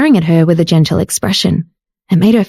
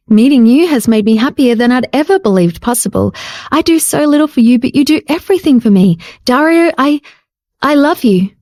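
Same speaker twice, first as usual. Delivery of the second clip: put-on higher, heartfelt young woman; tender female voice, halting at the end; tender confession; 4 semitones higher than usual. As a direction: pour out her love, hesitating on I. Jade confesses love.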